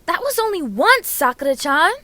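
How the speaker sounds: high, loud, offended yelp; indignant protest. Acted. Naruto defending himself to Sakura, loud indignant protest. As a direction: protest hotly like a caught-out kid. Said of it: "Naruto defends himself and Sasuke".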